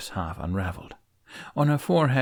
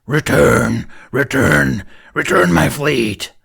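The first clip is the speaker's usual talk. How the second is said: huge, slow, much louder monster roar of a command; booming demon voice; 4 semitones higher, 11 dB louder, slower than usual. A narrator's reading. Demon summoning her fleet, booming monstrous roar. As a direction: bellow it like a vast beast calling her army.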